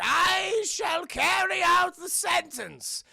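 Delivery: high, loud, very slow proclamation; King of Omashu. Retold or imitated. Old king passing judgment, hammed-up drawn-out decree. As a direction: stretch out a hammed-up royal decree. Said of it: "the King of Omashu".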